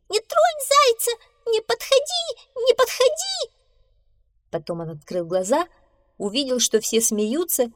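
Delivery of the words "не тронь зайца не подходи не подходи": very high squeaky shout; scared brave little mouse yelling; shouting with all his might. A narrator's reading. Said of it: "закричал изо всех своих мышиных сил". (изо всех сил, пискляво)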